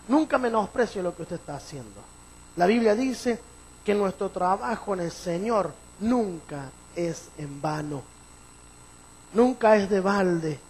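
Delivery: loud, driving sermon exhortation; preaching at full force. Acted. Preacher urging the congregation never to despise their work, loud. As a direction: push the message home, loud.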